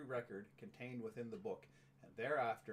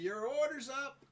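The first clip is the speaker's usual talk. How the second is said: high, loud, drawn-out order call; playful loud call-out; 13 semitones higher, 7 dB louder, slower than usual. Pretend. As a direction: sing out like a diner cook calling an order. Calls out segment catchphrase.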